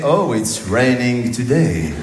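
slow, stretched schoolbook English; mock lesson recitation. Comic repeats silly school English phrases, slow mock recitation. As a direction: repeat it ploddingly, dragging every word. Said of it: "des phrases débiles à répéter".

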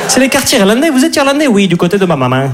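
put-on high, singsong southern French accent. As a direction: chat like a rom-com couple from the Midi. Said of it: "avec l'accent du Midi". (accent du Midi chantant, voix aiguë)

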